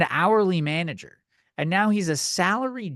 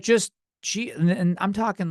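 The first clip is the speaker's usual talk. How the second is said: ordinary talk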